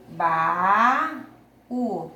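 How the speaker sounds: long drawn-out BA, a pause, then U; syllables separated. Shown as a demonstration. Teacher shows pupils the hiatus, ba and u said separately. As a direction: split baú into ba and u. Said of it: "quando eu separo as sílabas".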